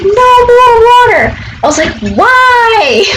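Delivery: high, drawn-out mock yell; retold yelling. Retelling yelling up to the third floor, mock yell. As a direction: re-enact yelling up at a window. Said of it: "I just started yelling".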